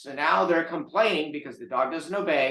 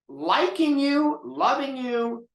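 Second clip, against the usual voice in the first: Liking you, loving you, said much higher, louder and slow; emphatic stress; 12 semitones higher, 4 dB louder, slower than usual. Real, not acted. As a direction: lean hard on each word, pitch way up, slowly. Stressing priorities to the audience.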